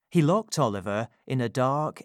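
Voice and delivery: ordinary delivery, nothing marked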